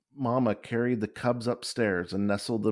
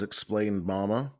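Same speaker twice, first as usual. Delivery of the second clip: usual speaking voice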